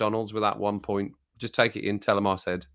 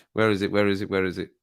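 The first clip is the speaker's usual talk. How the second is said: usual speaking voice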